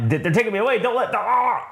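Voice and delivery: high, hammed-up protest breaking into a cry; mock panic. Man being taken away, mock panicked cry. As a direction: imitate a panicked prisoner.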